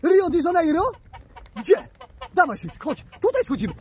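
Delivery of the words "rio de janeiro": normal talking voice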